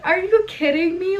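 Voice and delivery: high, loud exasperated disbelief; loud, high-pitched are-you-kidding-me. Retold or imitated. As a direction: throw it up high in mock outrage. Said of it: "I was like".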